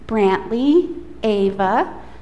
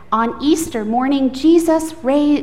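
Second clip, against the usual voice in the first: usual speaking voice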